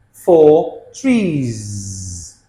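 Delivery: "trees" with a long buzzing z; z plural ending. Shown as a demonstration. Teacher models plurals, "trees" ending in a drawn-out z. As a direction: hold the final z of "trees".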